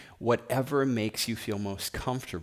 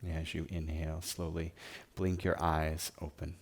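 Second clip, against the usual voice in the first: guided meditation; 2 semitones lower than usual. Acted. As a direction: guide them gently into stillness, phrase by phrase. Leads guided meditation and prayer.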